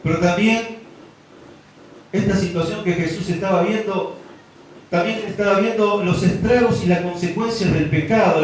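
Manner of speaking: a pastor building up to a climax; fervent preaching. Acted. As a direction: preach it out with full force. Preaching at full force.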